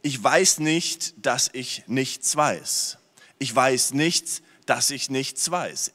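very slow, each phrase weighed; slow deliberate. Shown as a demonstration. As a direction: spell out the saying slowly. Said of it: "wo es heißt".

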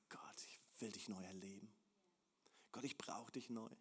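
soft whispered plea to God; hushed intimate whisper; whispered prayer. Acted. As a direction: breathe it like a private prayer. Quoted personal prayer, intimate.

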